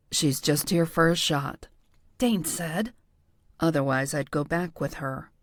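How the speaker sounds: low-key man's voice, barely shifted; lightly voiced Dane. A narrator's reading.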